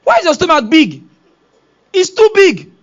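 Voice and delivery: put-on high, loud childish questions; a little kid blurting out; curious child. Child asks pregnant wife.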